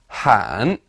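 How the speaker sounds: "Han" dipping low, then rising; third tone. Shown as a demonstration. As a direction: dip "Han" down and back up, third tone. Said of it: "third tone".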